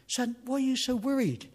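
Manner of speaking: high-pitched concerned questioning; concerned family. Acted. Bear family gathered round the son, high concerned voice. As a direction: ask as a worried bear family. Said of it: "they're getting a bit concerned".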